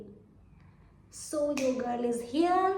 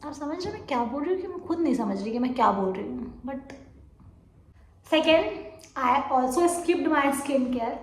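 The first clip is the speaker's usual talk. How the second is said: ordinary talk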